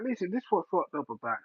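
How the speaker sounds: usual speaking voice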